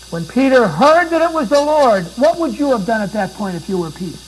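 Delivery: loud, high, ringing sermon climax; full-voiced pulpit preaching; preaching at full force. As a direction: preach it out to the back row.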